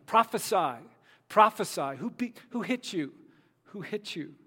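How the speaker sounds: loud, high taunt from the tormentors; biting mockery. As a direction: jeer at a blindfolded prisoner. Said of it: "the insults were biting".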